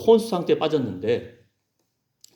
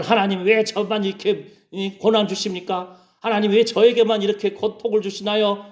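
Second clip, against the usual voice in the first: higher-pitched whining plea; acted grumbling in a raised pitch; mock resentful complaint; 6 semitones higher than usual. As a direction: complain up to God, why only me. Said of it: "이렇게 원망한다는 거예요".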